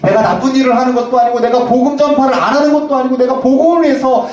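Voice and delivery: loud, anguished mock wail of a pastor; hammed-up tearful complaint to God; crying out to God. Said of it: "하나님 앞에 아이처럼 엉엉 울었다".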